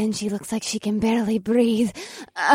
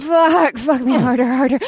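moaning; 2 semitones higher, 4 dB louder than usual. Acted. Female character moaning in sex scene.

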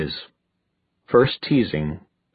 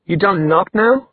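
high, affronted question; indignant; 9 semitones higher than usual. A narrator's reading. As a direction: scold an intruding brother. Glares at intruding brother.

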